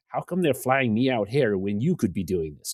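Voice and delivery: hammed-up American accent; bad American accent. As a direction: put on a terrible American voice to question a friend. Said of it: "forgive the worst American accent".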